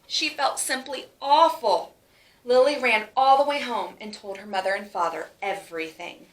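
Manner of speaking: ordinary delivery, nothing marked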